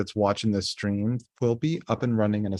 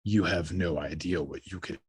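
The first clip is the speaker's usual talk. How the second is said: creepy voice; 5 semitones lower, 8 dB quieter than usual. Retold or imitated. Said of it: "He's like".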